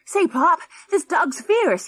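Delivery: put-on high, loud, panicky kid; alarmed boy. A narrator's reading. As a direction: yelp it to your dad. Boy alarmed by growling dog.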